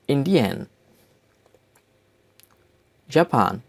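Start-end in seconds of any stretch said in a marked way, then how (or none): 0.1-0.7 "Indian" in German, as IN-dee-en; German-style "Indian" ending in 'en'; German pronunciation. Shown as a demonstration. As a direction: say "Indian" as the German country name. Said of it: "names of countries with German accent".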